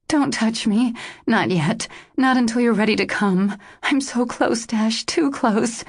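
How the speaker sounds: pleading woman's voice. A narrator's reading. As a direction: beg him to wait, slowing at the end. Narrator voices Joss pleading.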